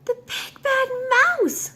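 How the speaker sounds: very high, loud, slow shriek; terrified yell. Acted. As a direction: scream it in terror at the shadow. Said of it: "yelled the Gruffalo's child".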